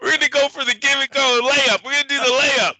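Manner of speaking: high-pitched mock coach voice; football coach. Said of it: "like the fucking college football coaches".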